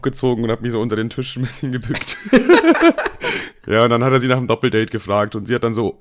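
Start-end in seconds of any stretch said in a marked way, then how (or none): 2.2-3.6 squealing high laugh; high-pitched laugh. Real, not acted. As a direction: burst into a shrill giggle. Hiding under blanket story. (hohes Kichern)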